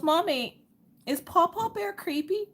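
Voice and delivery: put-on small, very high little-girl voice; imitating tearful child. Crying daughter asks mom about her bear, imitated tearful voice. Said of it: "little tears are falling".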